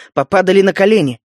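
ordinary delivery, nothing marked

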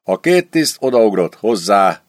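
ordinary delivery

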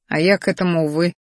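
plain reading voice

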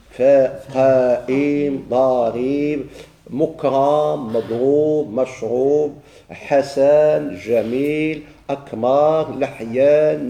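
each word spelled out slowly and separately for note-taking; dictation. Acted. Teacher dictates the list of words to students, slow dictation.

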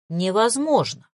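usual speaking voice

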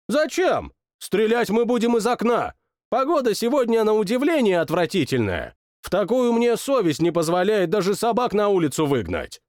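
high, self-satisfied royal declaration; pompous and pleased with himself; high-handed king. Acted. King refuses to go outside, high and pompous.

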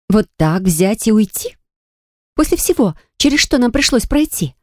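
rising indignant disbelief; protest, voice climbing high; indignant outburst. A narrator's reading. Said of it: "моего возмущения".

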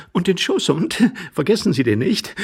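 reminding lady. Acted. Lady reminding him of the lapdog, lively. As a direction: call a playful reminder.

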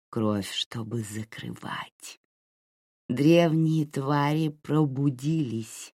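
slow, chanting creature voice; eerie possessed prophecy. Acted. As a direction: drag out each word like an oracle.

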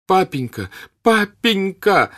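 high, loud, slow anguished call; sobbing cry. A narrator's reading. Son calling after his father, sobbing. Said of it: "закричал он ему вслед, рыдая".

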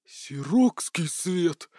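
very slow, awestruck exclamation; gasped amazement. A narrator's reading. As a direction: gasp it out in wonder. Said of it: "ахнул Бурхис".

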